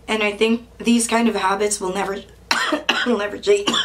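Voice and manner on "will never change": coughing and gagging mid-sentence; coughing fit. Real, not acted. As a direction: cough through the words. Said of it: "I'm coughing and gagging".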